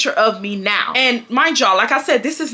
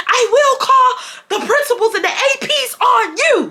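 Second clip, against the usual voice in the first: mock teacher yell, much higher and louder, laughing through it; 14 semitones higher, 3 dB louder than usual. Teacher threatening to call the APs, laughing mock yell. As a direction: shout the threat like a fed-up teacher, half laughing. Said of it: "you wanna yell at me".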